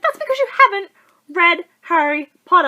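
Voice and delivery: high, loud comeback; exasperated retort. Retold or imitated. Fan answering guy who hasn't read it, acted exasperation.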